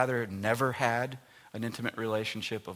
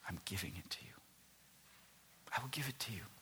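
tender whisper; 2 semitones lower, 13 dB quieter, much faster than usual. Acted. God gently offering help.

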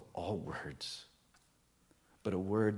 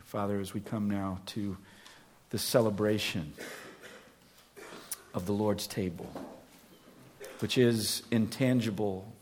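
earnest, louder public prayer; 4 dB louder than usual. Acted. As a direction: lift the congregation before the table. Leads congregation in prayer.

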